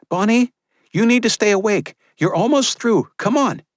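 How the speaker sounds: urgent midwife. Acted. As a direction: keep the mother awake, pleading. Maria voiced by narrator.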